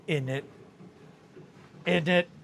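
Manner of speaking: loud, high mock bellow of "innit"; shouted innit. Joking about Brits' slang, shouted innit.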